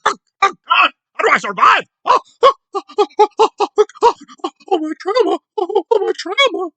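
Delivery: mocking her wailing about trauma. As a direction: ham up a melodramatic victim moaning. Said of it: "'cause that's the trauma".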